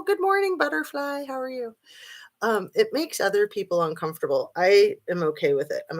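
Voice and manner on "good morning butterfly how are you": cheerful greeting. Real, not acted. Greeting a live chatter.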